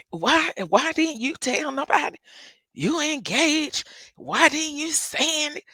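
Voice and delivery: scolding uncle. Retold or imitated. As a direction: scold her like an upset relative.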